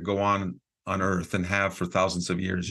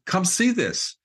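higher, louder excited come-see; eager invitation; 9 semitones higher, 3 dB louder, slower than usual. Retold or imitated. Cameraman calling the group over to look, excited. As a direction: wave everyone over, thrilled. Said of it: "And he says".

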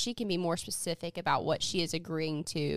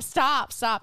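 higher, louder mock plea; begging; 6 semitones higher, 6 dB louder than usual. Retelling the heroine pleading with her tormentor, begging. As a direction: plead as if overwhelmed, half in play. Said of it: "She starts begging him to stop".

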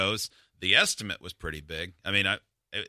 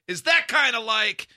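mock dumb question; 12 semitones higher, 4 dB louder than usual. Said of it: "like I was talking to someone as dumb as me".

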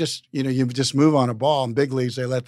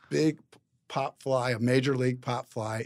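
normal talking voice

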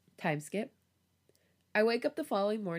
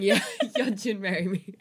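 louder, words broken by giggles; real laughing; 2 semitones higher, 7 dB louder than usual. Real, not acted. Co-host joining a joke proposal to Yeonjun, laughing. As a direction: echo the joke through laughter. Co-hosts joking, laughing together.

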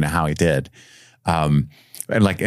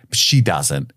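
ordinary delivery

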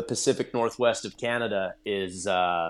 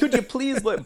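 mock pleading; 4 dB louder than usual. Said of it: "you're like".

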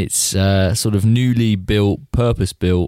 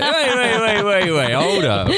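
high, urgent "wait, wait"; excited protest; 11 semitones higher, slower than usual. Real, not acted. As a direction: cut in, pitch shooting up. Sudden protest, pitch jumps.

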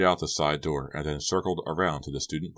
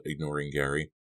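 plain reading voice

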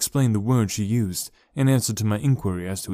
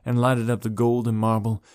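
earnest audiobook narration; story narration. A narrator's reading. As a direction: read it like a romantic classic. Long narrated reflection.

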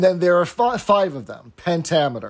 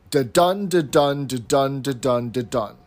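"da-dun" with stress on the second beat, fading each time; iambic beat. Shown as a demonstration. Host demonstrates iambic pentameter to co-host, with rhythmic chanting. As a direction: chant the iamb like a metronome. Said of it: "short, then long".

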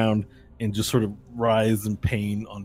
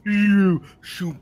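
fireball voice; 10 semitones higher, 7 dB louder than usual. Acted. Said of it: "And the fireball is like".

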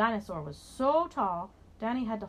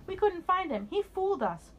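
put-on high, excited kids; children's voice; 11 semitones higher, 4 dB louder than usual. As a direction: gush like delighted children. Children praising the dinosaur.

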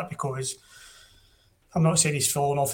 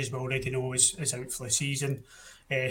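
ordinary delivery

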